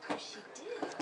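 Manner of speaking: ordinary delivery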